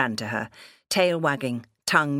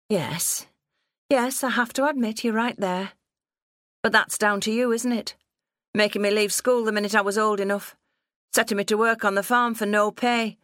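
put-on higher-pitched accusing young woman; resentful daughter; 7 semitones higher than usual. Daughter blaming father for farm drudgery, resentful. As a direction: turn agreement into indignant accusation.

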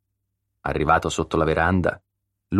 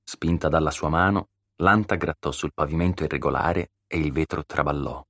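plain reading voice

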